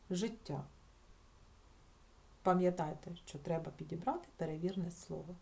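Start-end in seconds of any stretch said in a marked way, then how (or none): none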